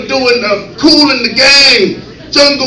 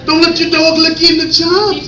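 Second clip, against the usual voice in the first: ordinary talk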